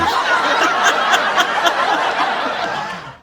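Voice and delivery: high, drawn-out laughing fit; long laugh. Real, not acted. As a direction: crack up at your own joke. Laughs at own soap joke.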